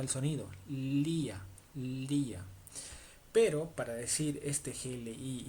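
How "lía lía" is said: Lía with a long, clear front l; tongue-tip L. Shown as a demonstration. Teacher demonstrates Spanish tongue position, careful pronunciation. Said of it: "La punta de tu lengua es la que choca con tu paladar".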